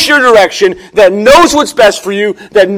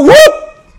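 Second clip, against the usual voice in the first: whoop; 6 dB louder than usual. Pretend. Yanking a child back from road.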